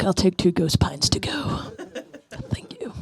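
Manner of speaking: laughing whisper; drunk whispered order. Retold or imitated. Tipsy comic orders wine to go in a laughing whisper.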